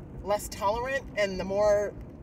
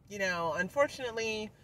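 ordinary delivery

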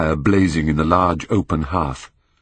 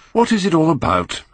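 much higher, sulky and indignant; petulant cry; 10 semitones higher than usual. A narrator's reading. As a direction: fling the question out like a spoiled young man. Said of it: "cried Dorian, in his petulant way".

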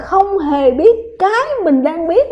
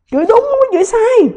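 high, loud declaration; loud emphatic high cry; emphatic exclaim; 8 semitones higher, 4 dB louder than usual. Real, not acted. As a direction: shout it out with conviction.

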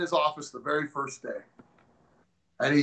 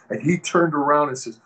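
ordinary talk, nothing marked

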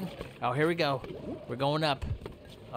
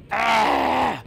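battle yell; 7 semitones higher, 8 dB louder than usual. Real, not acted. Fight with sea dragon.

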